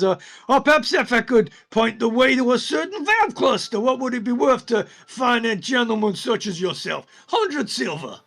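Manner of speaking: high, loud goblin pitching junk; goblin hawker. Acted. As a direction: sell rubbish like a pushy goblin. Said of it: "he says".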